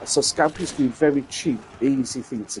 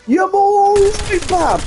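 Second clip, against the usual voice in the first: high, slow, loud Brummie voice; exaggerated Brummie welcome to Americans; Birmingham greeting; 6 dB louder, slower than usual. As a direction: greet the tourists in a hammed-up Birmingham accent. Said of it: "that's what they get greeted with".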